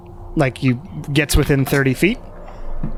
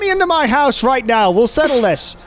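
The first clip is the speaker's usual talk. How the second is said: high, desperate yelling; frantic yelling; slower than usual. Acted. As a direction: yell it, frantic and indignant. GM voices panicked man yelling.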